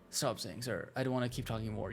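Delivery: low, soft complaint; weary grumble. Pretend. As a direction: grumble it quietly under your breath.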